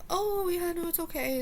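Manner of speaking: high-pitched put-on reassurance; fake forgiveness. Storyteller replaying her reply to the friend's apology, falsely nice. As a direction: act out being fine, way up high. Acts own insincere forgiveness.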